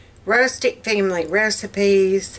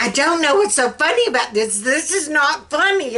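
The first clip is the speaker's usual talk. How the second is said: retold indignant protest, higher pitched; indignant friend; 7 semitones higher, faster than usual. Friend complains to her as she lies laughing, indignant. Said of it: "And she's like".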